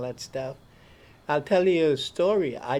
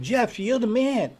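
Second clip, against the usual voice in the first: enthusiastic; 8 semitones higher, 5 dB louder than usual. Retold or imitated. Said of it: "he said".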